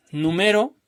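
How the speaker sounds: numero with a high stressed ME, rising; stress on middle syllable. Shown as a demonstration. Teacher shifting the tonic syllable, numero stressed on ME. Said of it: "vaya cambiando la sílaba tónica".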